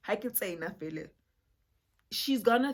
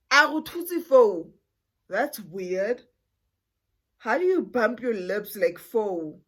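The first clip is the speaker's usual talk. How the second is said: suspicious aunt; 3 semitones higher, 12 dB louder, slower than usual. Retold or imitated. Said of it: "Ka ra ke thutse".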